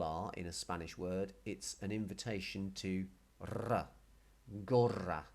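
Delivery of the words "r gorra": rolled R in "R, gorra". Shown as a demonstration. Teacher inviting learners to roll the R, rolled R in "gorra". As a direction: trill the double R in "gorra". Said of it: "if you can roll your R's".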